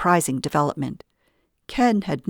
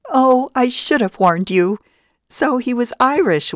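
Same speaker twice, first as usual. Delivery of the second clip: catching her breath after a fit of laughter; gasping through leftover laughs; gasped out; 8 semitones higher, 5 dB louder than usual. A narrator's reading. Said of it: "and gasped".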